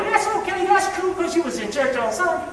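high-pitched, softened imitation of a lenient God; mocking, high-pitched "it's all fine" voice; indulgent mock voice. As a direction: let them off the hook in a high voice. Mimics an indulgent God winking at sin.

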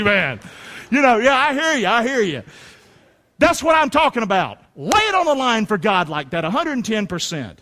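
ordinary talk

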